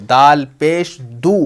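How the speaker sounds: slow "दाल पेश दू" with long final "दू"; pesh sound demo. Shown as a demonstration. Teacher pointing out the sound, slow rising "दाल पेश दू". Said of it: "उसी की आवाज़ निकालनी है".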